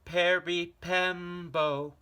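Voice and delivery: high, loud, slow desperate call; last-breath shout. Acted. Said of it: "he shouted".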